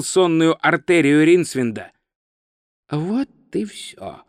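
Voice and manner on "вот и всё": soft, slow inner voice; resigned thought. Acted. Wizard being choked thinks it is over, soft and slow. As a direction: think it quietly, drawn out. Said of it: "подумал волшебник-недоучка".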